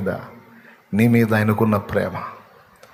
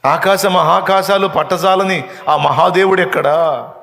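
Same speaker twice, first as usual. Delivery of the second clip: weeping devotee's lament; 7 semitones higher, 4 dB louder than usual. Retold or imitated. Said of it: "అని మళ్ళీ ఏడుస్తున్నాడంట".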